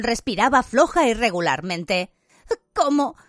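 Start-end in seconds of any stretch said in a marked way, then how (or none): 2.7-3.2 high, loud, halting distress; gasping, choking question. A narrator's reading. Hermione reacts to the execution, gasping in distress. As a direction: gasp it out, pitched very high. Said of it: "preguntó jadeando como si se ahogase".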